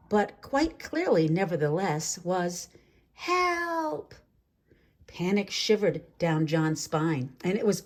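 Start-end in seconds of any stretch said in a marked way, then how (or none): 3.1-4.1 high, thin cry for help; a tiny creature begging; butterflies' plea. A narrator's reading. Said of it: "a thousand voices whispering in his mind".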